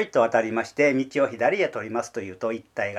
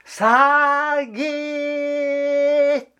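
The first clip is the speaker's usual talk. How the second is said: high, drawn-out call from far off; 13 semitones higher than usual. Retold or imitated. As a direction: call the heron from the distance. Calling the heron from afar. (遠くから鷺を呼ぶ声)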